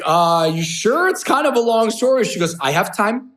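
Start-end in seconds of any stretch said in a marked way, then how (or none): 0.0-2.2 imitating his own reluctant, louder checking; hesitant. Him warning her it's a long story, hesitant. 2.6-3.3 imitated Icelandic woman, higher pitched; lightly Icelandic-accented reply. Icelandic woman telling him she has time, Icelandic accent. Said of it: "with the Icelandic accent".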